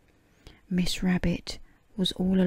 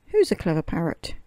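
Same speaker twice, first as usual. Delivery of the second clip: 1 parrot voice; 3 dB louder than usual. A narrator's reading. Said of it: "opened her beak and said".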